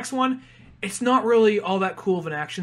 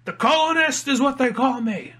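smug colonist boast; 7 semitones higher, 3 dB louder than usual. Retold or imitated. Mocking the British fighter.

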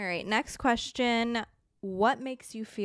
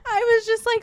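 high, squeaky laughing sobs; laughing through happy tears; laugh-crying; 10 semitones higher, 5 dB louder, faster than usual. Real, not acted. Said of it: "[name]'s sobbing right now".

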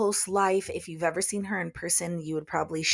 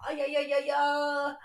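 gleeful; 9 semitones higher than usual. Real, not acted. Gleeful with studio to herself.

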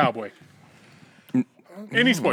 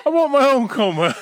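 higher, louder mock whine; 9 semitones higher, 5 dB louder than usual. Joking guy whining for his own coma like a child. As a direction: demand it like a spoiled kid.

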